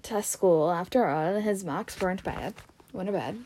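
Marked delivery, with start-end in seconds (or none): none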